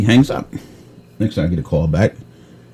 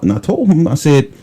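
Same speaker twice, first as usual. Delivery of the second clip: usual speaking voice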